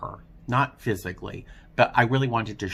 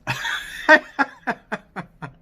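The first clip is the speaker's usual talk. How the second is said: laughing; 13 semitones higher, 3 dB louder than usual. Real, not acted. Laughs at himself.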